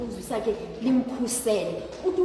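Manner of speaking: ordinary talk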